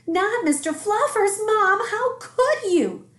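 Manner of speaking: high, loud, slow wail of betrayal; outraged child. A narrator's reading. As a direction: cry out like a wounded little boy. Child protects favourite rabbit.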